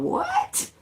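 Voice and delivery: very high-pitched incredulous squeak; retold disbelief. Tenant handed the landlord's slip, high incredulous reaction. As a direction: shoot way up in mock disbelief. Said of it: "And I was going".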